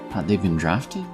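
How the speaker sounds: plain reading voice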